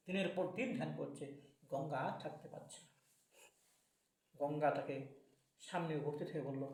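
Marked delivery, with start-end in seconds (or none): none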